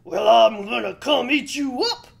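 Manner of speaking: very loud, threatening troll. A narrator's reading. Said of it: "said the troll".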